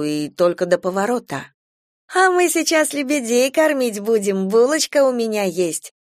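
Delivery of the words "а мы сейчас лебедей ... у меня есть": put-on high cooing to a child. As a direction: coo sweetly at the child. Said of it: "воркует тётечка".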